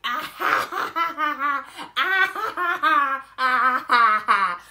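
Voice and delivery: loud, high imitated mocking laugh. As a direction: cackle right in her face, showing off. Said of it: "she's laughing in my face like".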